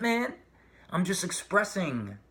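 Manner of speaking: normal talking voice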